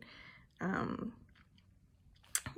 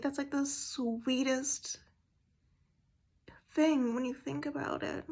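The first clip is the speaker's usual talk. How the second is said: tearful whisper; 5 semitones higher, 6 dB quieter, slower than usual. Real, not acted. Moved, near tears.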